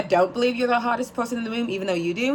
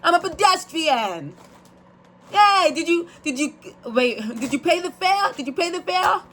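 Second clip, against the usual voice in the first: hammed-up New York accent, pitched high; New Yorker imitation; 8 semitones higher than usual. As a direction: play an indignant New Yorker, high and emphatic. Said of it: "I was like, "What do they say?".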